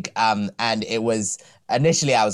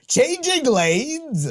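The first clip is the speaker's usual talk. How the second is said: high, stretched-out mock cry; Finn; much slower than usual. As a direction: draw it out high in imitation. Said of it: "When Finn was waving his hands, going".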